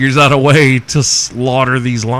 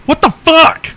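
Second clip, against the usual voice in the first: high, loud startled yelp; a sudden shocked squeal; shocked outcry; 5 dB louder than usual. Retold or imitated. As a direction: shriek it like she's just been splashed. Retold woman's shocked outcry.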